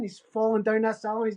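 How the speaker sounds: ordinary talk, nothing marked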